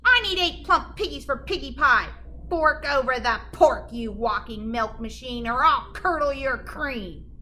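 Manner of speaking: loud, demanding witch. Acted. Gritch demanding pigs from the cow, loud and bossy. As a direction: bark it out like a hungry witch.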